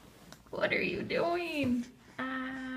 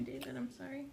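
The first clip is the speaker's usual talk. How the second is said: soft whispered apology; whispered sorry; 9 dB quieter, faster than usual. Real, not acted. Apologizing after dropping food.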